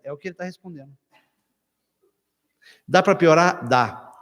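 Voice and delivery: normal talking voice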